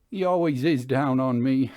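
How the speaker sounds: a worn-down man complaining; bitter driver. A narrator's reading. Sim answers bitterly.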